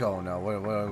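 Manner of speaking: usual speaking voice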